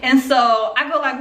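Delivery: usual speaking voice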